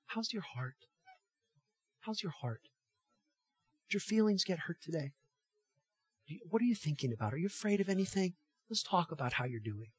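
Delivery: very soft, tender bedtime check-in; gentle, kind. Acted. Father checking on his child at bedtime, gentle and kind. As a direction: ask softly, like a caring dad. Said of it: "There's this gentleness and kindness".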